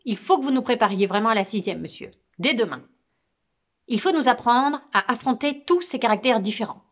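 firm, commanding request; a touch of command toward the teacher; like an order. Acted. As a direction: tell the teacher what to do. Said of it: "un peu comme on donne un ordre".

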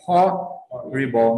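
"horrible" slowly, with an audible H; sounded H. Shown as a demonstration. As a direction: stretch out "horrible", breathing out the first H. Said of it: "esse H não é mudo". ("horrible" devagar, com H aspirado)

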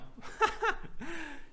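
short high chuckle in bursts; real, high little laugh. Real, not acted. Drum teacher amused by own fumble, high chuckling.

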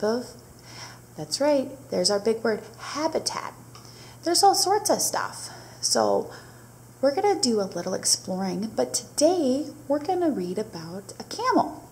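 put-on bright teacher voice for young kids; reading to children. Teacher opening a storybook lesson for her class, bright and friendly.

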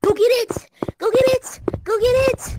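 loud frantic urging; frantic shouting. Real, not acted. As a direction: shout like it's urgent.

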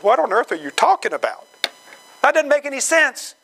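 puzzled protest, pitch climbing at the end; bafflement. Acted. Peter, eyes glazed over, answering Jesus, baffled.

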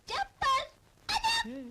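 high, tearful cry to a husband; anguished wail. Acted. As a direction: wail out in hurt dismay. Rejected wife calls to her husband. (acıyla ağlamaklı feryat)

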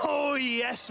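gleeful shout on hearing the award; excited shout. Acted. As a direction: cheer it out like a winner. Celebrating man of the match award.

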